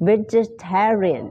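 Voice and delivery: slow vegetarian with a v sound, said a bit high; vegetarian demo. Shown as a demonstration. Teacher slowly modelling vegetarian for students. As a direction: spell out vegetarian syllable by syllable. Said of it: "ออกเสียง vegetarian นะ".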